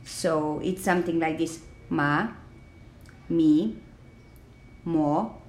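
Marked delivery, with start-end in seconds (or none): 1.9-5.4 rising tone on "Ma, mi, mo"; second tone. Shown as a demonstration. Teacher showing the second tone, "Ma, mi, mo" each rising. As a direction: start "Ma, mi, mo" lowish and go straight up. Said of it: "starts a little bit lower than the first tone".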